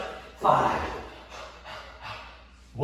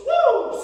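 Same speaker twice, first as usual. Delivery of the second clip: high loud cheer; excited woo; 7 dB louder than usual. Real, not acted. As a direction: whoop with joy. Hype cheer.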